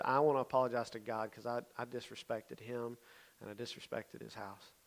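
soft, slowing confession; humble apology. Retold or imitated. Boy apologizing for disrespecting God, quiet and humble.

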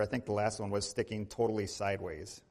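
ordinary talk

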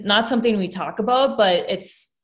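ordinary talk

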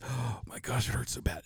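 breathy whispered moan of pain; hushed, hurting whisper. Retold or imitated. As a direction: whisper it like the burn is unbearable. Sister in pain at burn center.

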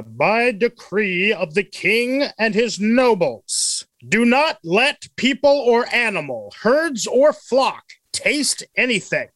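slow, high, grand royal proclamation; pompous king. Pretend. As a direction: read it like a pompous king's decree. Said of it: "best pompous king reading".

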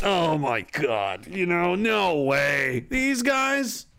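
hammed-up scornful dictator; Joseph Stalin. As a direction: sneer in disbelief like an old dictator. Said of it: "The ghost of Joseph Stalin is like".

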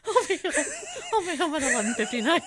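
fast, loud, laughing promise; scared teen giving in, laughing; laughing daughter. Acted.

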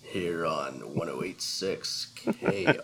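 slow, loud, laughing station ID; radio DJ voice. Pretend. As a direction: boom out the call letters. Station call sign.

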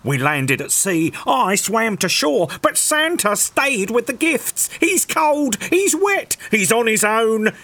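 high anxious reindeer voice; pleading reindeer. Acted. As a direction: beg for help urgently.

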